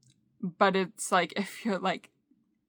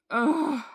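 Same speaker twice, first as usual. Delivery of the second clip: higher exasperated "ugh"; frustrated groan; 5 semitones higher, 4 dB louder than usual. Real, not acted. Venting about unwanted anxiety, frustrated groan.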